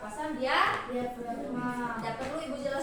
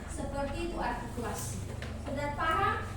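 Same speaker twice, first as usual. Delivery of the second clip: usual speaking voice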